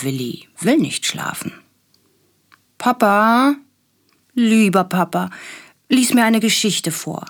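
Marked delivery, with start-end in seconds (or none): 2.8-7.2 put-on high pleading little boy voice; begging child. A narrator's reading. Willi begging Papa for a bedtime story, high and pleading. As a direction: wheedle like a four-year-old begging for a story. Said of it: "bettelt er".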